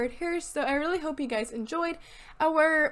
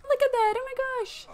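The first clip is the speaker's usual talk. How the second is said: excited squeal; 10 semitones higher than usual. Real, not acted. Cooing at cute pets.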